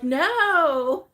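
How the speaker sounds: loud, high drawn-out objection; playful retold protest; mock appalled refusal. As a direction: imitate someone balking in disbelief. Retells daughter-in-law's shocked refusal.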